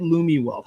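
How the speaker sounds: "Lumi" with an ee ending, LOO-mee; Lumi pronunciation. Shown as a demonstration. Host checking the company name with the guest, "Lumi" said LOO-mee. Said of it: "did I say it wrong".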